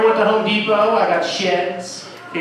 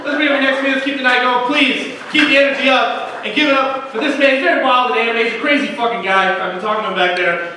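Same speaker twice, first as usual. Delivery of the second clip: club announcer; 2 semitones higher than usual. Acted. Emcee introduces the next comedian, hyped. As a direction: keep the room buzzing as you bring him up. Host hypes next comic.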